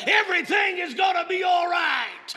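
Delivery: loud, high, rhythmic preaching; impassioned sermon climax; preaching at full force. Acted. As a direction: drive it home to the congregation.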